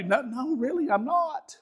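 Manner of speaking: high-pitched, unraised protest; imitated backpedalling in a high voice; pleading denial. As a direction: squeak out a hasty reassurance. Coach protesting to crying daughter.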